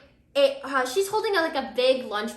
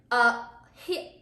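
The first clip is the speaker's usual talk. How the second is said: usual speaking voice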